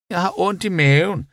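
high-pitched whine; complaining. A narrator's reading. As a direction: moan about it in a high voice. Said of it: "klagede hun". (klagende, høj stemme)

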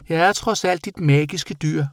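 high-pitched little creature; bat character. A narrator's reading. Magical animal addressing its boy, high bat voice.

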